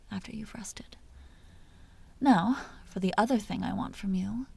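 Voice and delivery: a vampire's hushed, teasing whisper close to the ear; seductive whisper. Acted. Vampire whispering to the hunter she wants to bite, seductive whisper. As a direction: lure him in with soft breathy secrets.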